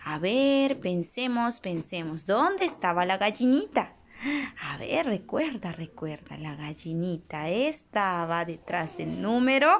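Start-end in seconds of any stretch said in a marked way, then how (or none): 1.6-2.3 lower, much softer repeat; soft echo. Acted. 5.7-9.7 lower, almost inaudible repeat; faded echo. Acted. Repeated word, echo softer.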